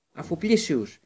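put-on desperate boy shouting a spell at the traitor; shouted spell. A narrator's reading. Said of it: "φώναξε ο Χάρης".

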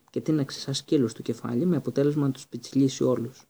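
ordinary delivery

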